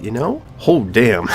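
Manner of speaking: ordinary talk, nothing marked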